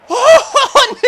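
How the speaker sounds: very high, loud "oh" cry; piercing real yelp; near-miss scream. Real, not acted. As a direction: shriek as the chance goes.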